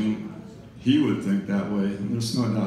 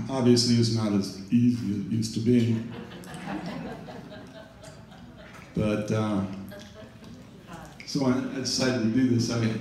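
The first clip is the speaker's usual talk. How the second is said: talking while chewing gum; words around a wad of gum; 3 dB quieter than usual. Real, not acted. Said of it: "You're chewing gum".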